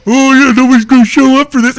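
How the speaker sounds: high, loud mocking sarcasm; sarcastic. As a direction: rub it in with a squeaky sneer. Sarcastic remark to McGee.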